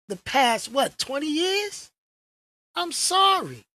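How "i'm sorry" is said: mock apology. Real, not acted. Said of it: "mock apology".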